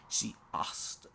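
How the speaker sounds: ordinary delivery, nothing marked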